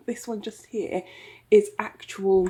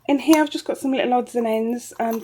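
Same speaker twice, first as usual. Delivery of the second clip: ordinary delivery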